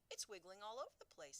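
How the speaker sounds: crying out. Acted. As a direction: cry out, alarmed. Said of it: "Sophie cried".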